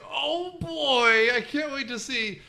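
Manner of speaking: put-on high, eager kid voice; mock excited. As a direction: gush like an excited fan. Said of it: "it wasn't like I was like".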